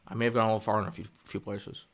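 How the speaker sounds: lightly imitated director, subdued; a hint of a halting filmmaker's voice; George Lucas. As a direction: mumble it, tailing off. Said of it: "practice my George Lucas impression".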